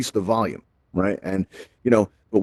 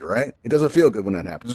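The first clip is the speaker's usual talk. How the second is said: ordinary talk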